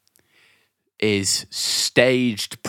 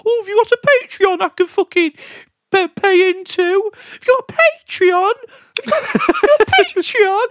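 much higher, louder squeaky fan voice; mocking fan voice; 5 dB louder than usual. Mocking eager fans begging for a Patreon, squeaky. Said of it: "all these fucking idiots are like".

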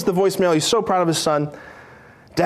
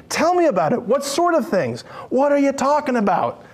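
higher, interested asking; eager engaging; 7 semitones higher than usual. Acted. Jesus inviting disciples to talk, eager and higher. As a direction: draw them into talking. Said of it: "he's engaging them in conversation".

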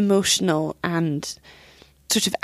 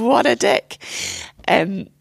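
ordinary delivery

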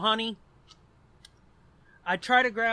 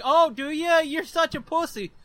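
high, mocking jeer; retold taunt; 7 semitones higher, 3 dB louder than usual. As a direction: tease him with a raised, mocking voice. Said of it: "and it's like".

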